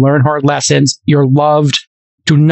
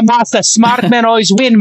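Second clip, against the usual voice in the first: lightly put-on Japanese accent, higher; a playful Masa impression in a Japanese accent; mock Japanese accent; 8 semitones higher than usual. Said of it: "I can do a Japanese accent".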